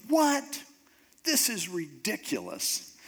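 high, incredulous outburst on the first word; mock furious. Naaman hearing the order to dip seven times, furious. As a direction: act out indignant disbelief without much volume. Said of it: "Naaman just gets furious".

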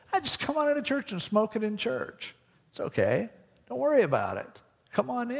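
lightly voiced pastor talking him into it; urging pastor.

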